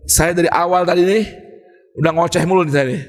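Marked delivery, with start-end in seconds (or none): none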